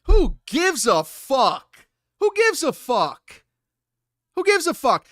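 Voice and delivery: exasperated at chat, climbing in pitch; fed-up streamer building on the line; exasperated rant. Real, not acted. Ranting at chat about gossip.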